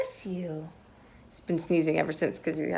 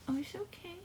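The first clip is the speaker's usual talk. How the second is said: high, soft, reassuring praise; soft encouraging coo; 5 semitones higher, 7 dB quieter than usual. Real, not acted. Mom clearing baby's stuffy nose, soft praise.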